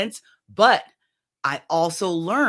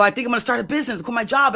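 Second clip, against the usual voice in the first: crying; 8 semitones higher, 4 dB louder, faster than usual. Retold or imitated. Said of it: "and I'm crying".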